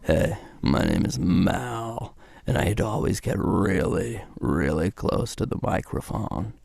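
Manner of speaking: slow, low vocal fry; deep, drawn-out fry character voice; Mal. Shown as a demonstration. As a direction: drop way down and creak, close on the mic. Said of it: "way down here".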